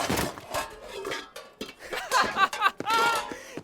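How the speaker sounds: a rising, gloating high laugh; a gleeful high-pitched jeer of a laugh; high mocking laugh. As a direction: cackle at the fool, high and delighted. Taunts a struggling guard.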